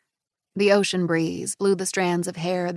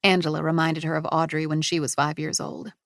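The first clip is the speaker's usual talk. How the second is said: ordinary delivery, nothing marked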